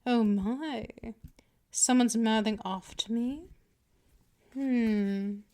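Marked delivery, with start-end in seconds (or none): none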